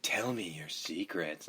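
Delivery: very soft, low, slow seduction; sexy voice. Retold or imitated. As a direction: purr it like a phone-sex line. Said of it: "it's a rather sexy voice there".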